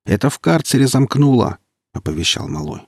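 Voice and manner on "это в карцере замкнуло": announcing it loudly, higher up; shouting to the others that it shorted. Acted. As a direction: call out the news down the corridor. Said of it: "раздался громкий голос".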